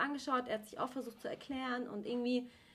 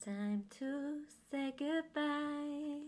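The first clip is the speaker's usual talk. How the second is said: slow drawn-out; 3 semitones higher, much slower than usual. Pretend. Quoting song title, drawn out.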